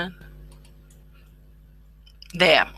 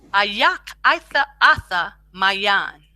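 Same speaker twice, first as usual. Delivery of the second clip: slow demo; slower than usual. Shown as a demonstration. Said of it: "You can speak it out".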